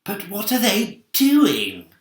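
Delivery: put-on high, slow, anxious old voice; old grasshopper. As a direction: wonder it nervously. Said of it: "grasshopper whispered".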